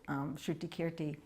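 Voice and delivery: ordinary talk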